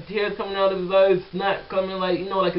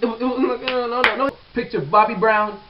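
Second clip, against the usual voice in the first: ordinary talk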